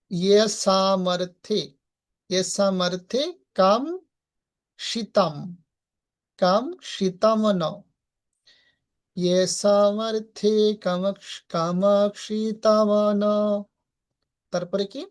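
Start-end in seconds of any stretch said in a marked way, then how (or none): 0.1-5.3 ইয়ে সামর্থে stretched slowly word by word; slow broken reading. Shown as a demonstration. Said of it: "ভেঙে ভেঙে উচ্চারণ". 6.4-13.2 কামক্ষিতম drawn out very slowly. Shown as a demonstration. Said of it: "ভেঙে ভেঙে উচ্চারণ".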